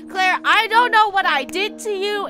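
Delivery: shouting over the sounds. Real, not acted. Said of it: "I'm just gonna scream over it".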